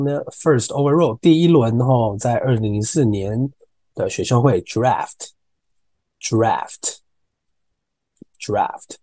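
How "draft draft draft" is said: "draft" very slow, repeated; slow drill of draft. Shown as a demonstration. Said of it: "draft这个字". (反复慢念 draft)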